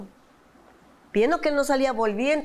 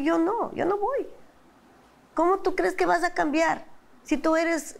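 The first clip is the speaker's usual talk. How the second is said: higher-pitched scornful challenge; retold insulting; 8 semitones higher than usual. Wife retelling how she insulted her husband, scornful and high. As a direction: recount berating him, voice raised in pitch. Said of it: "yo le insultaba muy, muy feo".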